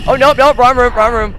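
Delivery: high loud startled nope; panicked yelp. Real, not acted. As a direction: yelp and back out fast. Bursts into wrong room.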